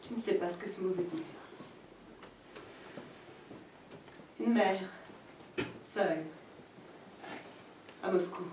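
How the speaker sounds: très bas, presque éteint; dis-le comme un aveu douloureux; deuil brisé. Acted. (aveu de deuil à voix éteinte)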